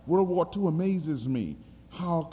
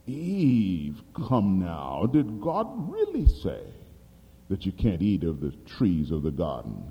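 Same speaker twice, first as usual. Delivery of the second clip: serpent's voice; 10 semitones lower than usual. Retold or imitated. Preacher voices the serpent again.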